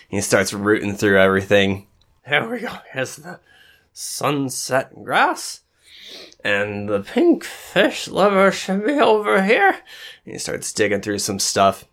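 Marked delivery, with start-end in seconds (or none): 2.3-9.8 high, put-on character voice; rummaging eccentric. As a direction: mutter high to yourself while hunting for things.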